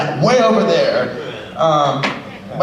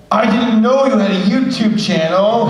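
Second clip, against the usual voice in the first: laughing mock coworker; 8 semitones higher, 4 dB louder, slower than usual. Retold coworker's text.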